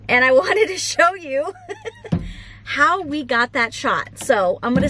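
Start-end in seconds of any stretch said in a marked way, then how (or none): 1.6-2.4 short high giggle, breathy; high laugh. Real, not acted. Owner giggling while showing the Instagram photo, high giggle. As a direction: let a high giggle slip out.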